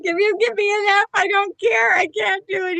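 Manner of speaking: high, loud, fast panicked protest; mock panicked refusal. Student refusing the professor, frantic high protest. Retold refusal to read paper.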